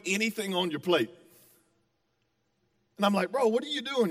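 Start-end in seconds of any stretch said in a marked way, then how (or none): 3.3-4.1 higher-pitched mock disbelief; retold incredulity. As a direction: question a buddy in playful bafflement, pitch raised. Retold challenge to idle tablemate.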